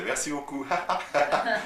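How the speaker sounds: high, loud thanks dissolving into laughter; laughing thanks. Retold or imitated. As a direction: say thanks while cracking up. Imitates himself thanking, laughing. (remerciement haut et fort en riant aux éclats)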